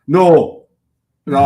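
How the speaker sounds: usual speaking voice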